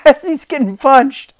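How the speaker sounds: ordinary talk, nothing marked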